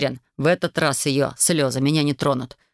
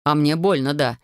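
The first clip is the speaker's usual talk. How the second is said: ordinary delivery, nothing marked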